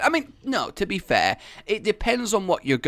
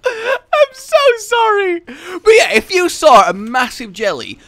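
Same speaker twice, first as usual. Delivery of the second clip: high laugh-crying voice; teary laughter; 13 semitones higher, 8 dB louder than usual. Real, not acted. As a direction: still laughing to tears. After laughing fit, crying laugh.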